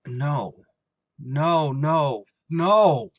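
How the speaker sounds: horror building into a yell; rising shout of dismay. Acted. Pilot horrified at stowaway.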